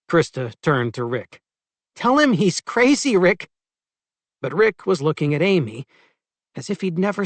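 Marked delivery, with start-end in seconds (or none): 2.0-3.5 high, loud disbelieving protest; indignant protest. A narrator's reading. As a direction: scoff at the accusation loudly.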